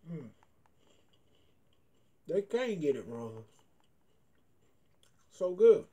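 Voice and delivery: talking while chewing snack; muffled through food; mouth full, chewing. Real, not acted. Seen 0: Puts snack in mouth at -1; lips pursed, jaw working throughout.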